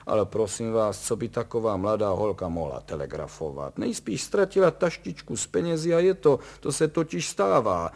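nervy, grouchy dismissal; grumbling impatience. A narrator's reading. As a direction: grumble loudly as a nervous expert explaining the obvious. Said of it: "bručel pan Horvát".

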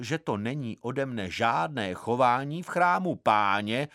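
ordinary delivery, nothing marked

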